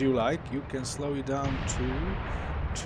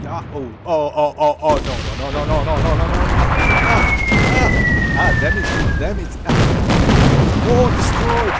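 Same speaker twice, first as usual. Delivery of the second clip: dismayed shouting; 3 semitones higher, 15 dB louder, faster than usual. Real, not acted. Losing units in battle game.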